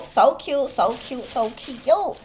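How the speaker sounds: ordinary talk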